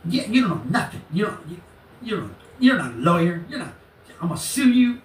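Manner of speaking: high, stumbling agitated imitation; mimicking his ranting. Imitating the man threatening to sue the lawyer, high mock rant.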